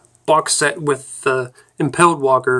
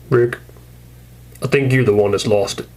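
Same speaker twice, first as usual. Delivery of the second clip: ordinary delivery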